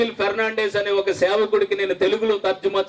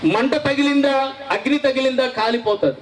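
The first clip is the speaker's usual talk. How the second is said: high, fervent pulpit cry, pitched well up; preaching at full force; 7 semitones higher than usual. Acted. Preacher rousing his congregation, high fervent preaching cry. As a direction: drive it home to the congregation with fire.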